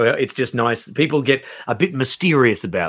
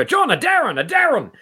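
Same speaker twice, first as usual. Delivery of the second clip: imitating a fan calling out, pitched much higher; thrilled mock shout of recognition in the street; excited fan yell; 13 semitones higher than usual. Fan spotting him in public, calling his name in mock excitement. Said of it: "yells Darren at me in this really excited way".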